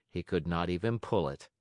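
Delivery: usual speaking voice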